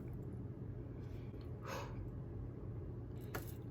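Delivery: long, quiet exhale; nervous sigh. Real, not acted. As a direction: breathe out slowly to steady the nerves. Steadying herself before waxing.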